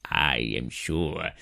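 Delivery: put-on low, slow, soft old man voice; grandpa voice. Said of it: "talked more like that, like a grandpa".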